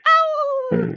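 high, loud cartoon yelp; mock Goofy. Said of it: "No, that's Goofy".

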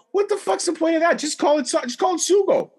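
high-pitched comic indignation; retold rant in a squeaky-high register; exasperated outburst. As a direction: protest incredulously, voice way up high. Retells his outburst at Sopranos' gravy.

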